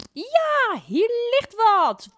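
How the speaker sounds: very high, loud and slow; excited find. A narrator's reading. Mevrouw Poepies finding something, high excited squeal.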